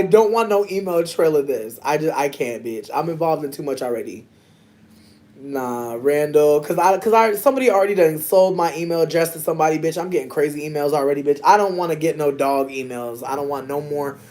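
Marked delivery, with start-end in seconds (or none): none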